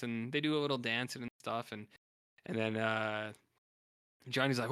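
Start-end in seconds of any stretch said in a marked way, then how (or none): none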